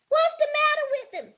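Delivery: very high squealed question; high-pitched girlish cry; girls yelling. A narrator's reading. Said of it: "The girls yelled out".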